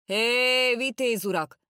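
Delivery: high, loud hail after the riders; calling after riders to stop, loud. A narrator's reading. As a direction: shout it after the horsemen from behind. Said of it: "utána kiáltok a lovasoknak".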